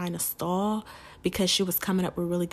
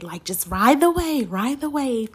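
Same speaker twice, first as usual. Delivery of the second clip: pumped-up self-talk; 5 semitones higher, 6 dB louder than usual. Retold or imitated. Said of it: "the things that I'm telling myself".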